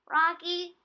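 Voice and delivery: high, loud chirpy little voice; Teeny's voice. A narrator's reading. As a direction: squeak it out brightly. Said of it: "Teeny said".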